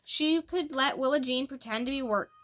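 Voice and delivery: plain reading voice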